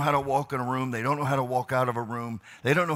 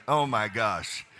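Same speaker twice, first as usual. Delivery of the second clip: normal talking voice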